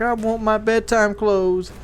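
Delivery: mock crying little boy; whiny crying kid. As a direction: mimic a toddler whimpering for his pajamas. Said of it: "then he starts crying and freaking out".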